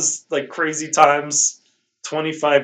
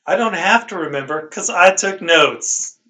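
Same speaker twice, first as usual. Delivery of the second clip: higher and louder, a proud boast; said very loudly; 6 semitones higher, 4 dB louder than usual. Real, not acted. Host boasting to cohost about his notes, loud. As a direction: announce it loudly, showing off the notes. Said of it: "And I said that very loudly".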